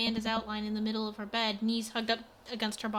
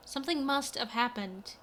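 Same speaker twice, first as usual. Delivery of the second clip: usual speaking voice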